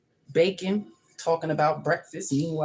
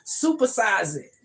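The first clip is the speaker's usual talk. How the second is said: louder and higher, slow and eager; higher, louder, drawn-out supersize hype; hyped-up America; 7 semitones higher, 6 dB louder, slower than usual. Pretend. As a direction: sell it like a greedy fast-food pitch. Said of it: "America said".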